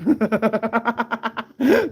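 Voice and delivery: high, loud belly laugh; real laughter. Real, not acted. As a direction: crack up at a joke about yourself. Laughs at viewer's hair joke.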